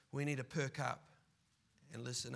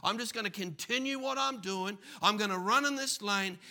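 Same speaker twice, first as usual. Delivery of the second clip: louder, higher self-assured boast; smug careless man; 4 semitones higher, 6 dB louder than usual. Acted. Preacher voicing the man who thinks life never ends, cocky. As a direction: boast like nothing can touch you.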